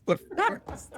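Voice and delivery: high, pained whine of disgust; a hammed-up, high-pitched "oh, the worst"; mock disgust. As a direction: groan it out like you can't bear the sound. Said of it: "and you'd be like".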